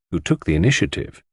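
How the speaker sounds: usual speaking voice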